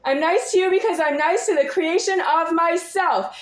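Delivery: usual speaking voice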